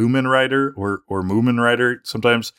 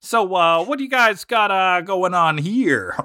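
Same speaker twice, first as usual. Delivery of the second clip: put-on high, halting old-Hollywood American accent; Jimmy Stewart impression; 8 semitones higher than usual. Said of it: "I guess that's my Jimmy Stewart".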